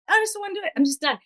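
put-on high, sulky kid-like refusal; whiny refusal. Talking back to the mom, high sulky refusal. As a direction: dig in like a stubborn child. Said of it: "And I'm like".

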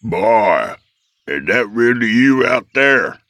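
put-on old father calling from inside; crying out. A narrator's reading. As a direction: call out to the boy, drawn-up pitch. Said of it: "A voice from the cabin cried out".

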